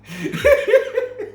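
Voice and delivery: high-pitched, loud cackle; imitated eerie laugh. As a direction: laugh high as a startling God would. God's laugh as he looks at you.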